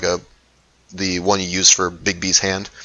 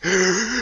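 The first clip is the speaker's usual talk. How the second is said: sharp offended gasp; shocked gasp; 10 semitones higher, 5 dB quieter than usual. Acted. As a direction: gasp in outrage. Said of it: "they're just like".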